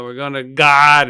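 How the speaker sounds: loud, drawn-out Boston accent; mock Boston accent. Shown as a demonstration. Fan messing around with words, in mock Boston accent. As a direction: stretch it in a put-on Boston accent. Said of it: "messed around with the Boston accent".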